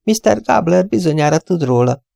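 put-on high, light voice of a young woman on the phone; chirpy office girl, a bit scatterbrained; young receptionist. Young receptionist answering callers on the phone, high and chirpy.

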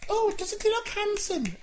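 high grandma scolding; Doreen old lady voice. Pretend. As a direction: nag high like an old wife.

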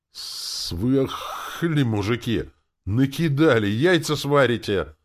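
loud, high outburst of protest; hissing angry cursing. A narrator's reading. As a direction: cry out as if scalded by steam. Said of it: "послышался его мат и шипение".

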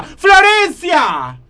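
grito agudo llamando a alguien; llamá fuerte a tu sobrina, estirando el nombre; llamado a gritos. Acted. (gritando para que venga)

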